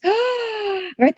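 high, mock gasp of delight. Parent admiring a child's scribbles, mock delighted gasp. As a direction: gasp like a parent thrilled by a drawing.

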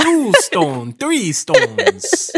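climbing high mock vampire count; triumphant hammed-up puppet counting. Counting stones as joke, vampire voice. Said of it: "The Count?".

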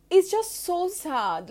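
slow, pained and high, voice rising with grief; sad. Real, not acted. Podcaster mourning George Floyd, slow and pained. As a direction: let the sorrow swell and stretch the words. Grieving over the killing.

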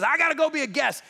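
acted excited volunteer; eager acceptance. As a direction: blurt it out, delighted to be invited. Zacchaeus eagerly accepts Jesus' visit.

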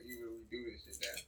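normal talking voice

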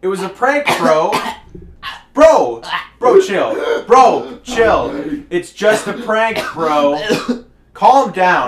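loud insistent yelling; shouting bro. Acted. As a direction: yell at him to chill. Prank defended to angry friend.

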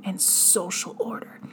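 whispered phrase within a reading; hushed theatrical reading; dramatic whisper. Acted. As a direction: drop to a whisper for effect.

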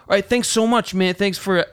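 put-on high, friendly Brooklyn accent; cheerful Brooklyn guy, lightly voiced. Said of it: "Brooklyn accent".

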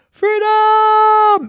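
very high, loud, held cry; triumphant yell. Acted. Rocky escapes the jar, triumphant yell. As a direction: yell it out at full height.

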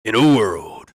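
playful mock trailer narrator; movie trailer voice. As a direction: echo a trailer announcer.

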